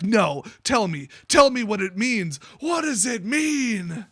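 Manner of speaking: mock demand building into a drawn-out shout; retold demand. Retold demand growing more frantic.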